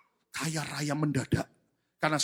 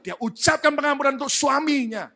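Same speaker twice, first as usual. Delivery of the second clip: loud, raised preaching voice; impassioned proclamation; 11 semitones higher, 12 dB louder than usual. Real, not acted. Preacher announcing the wife's forgiveness, impassioned proclamation. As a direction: proclaim it forcefully, easing at the end.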